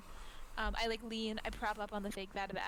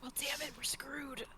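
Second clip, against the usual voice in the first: whispering; 4 semitones higher than usual. Real, not acted. Mock dismay at missed message.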